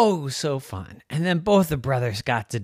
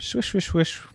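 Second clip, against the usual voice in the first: higher, odd swishing gibberish; mock turtle talk; 7 semitones higher than usual. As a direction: pretend to speak turtle. Fake turtle language.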